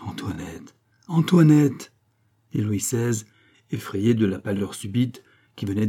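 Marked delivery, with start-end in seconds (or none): 0.0-0.8 name said low and soft; softer, lower first call to her; quiet call. A narrator's reading. King addresses his wife Antoinette, quiet call. 1.1-1.9 loud, high call of her name; alarmed call. A narrator's reading. Frightened king calls his pale wife, alarmed call.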